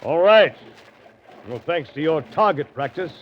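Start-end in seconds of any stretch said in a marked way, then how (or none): 0.0-0.5 loud, raised call up to the deck. Acted. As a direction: shout it so they hear you. Calling out after the attack.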